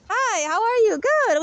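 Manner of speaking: high, bright friendly exchange; cheerful greeting. Shown as a demonstration. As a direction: sound happy to see them. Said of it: "We're just nice".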